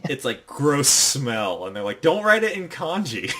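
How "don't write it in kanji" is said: raised-pitch mock scolding; retold group complaint, pitched up; exasperated protest. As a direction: tell them off in playful exasperation. Group protests at horrible spelling.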